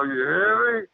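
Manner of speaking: high-pitched, insistent retold warning. Retold warning to creature in tree.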